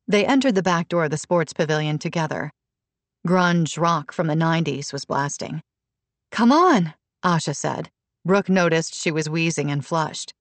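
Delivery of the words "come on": high, loud urging; urgent. A narrator's reading. Asha urging Brooke toward the pavilion, urgent high call. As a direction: hurry her along.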